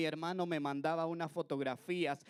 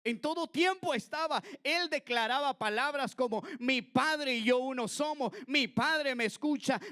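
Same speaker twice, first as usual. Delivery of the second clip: higher, louder preaching voice; fervent preaching; 7 semitones higher, 4 dB louder than usual. Acted. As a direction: proclaim with fervor. Predicación elevada. (predicación fervorosa y elevada)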